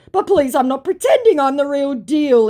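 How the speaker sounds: high, loud mock pleading; pleading impostor. Impostor insisting he's the real deal, mock pleading. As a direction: act out the fake's protest.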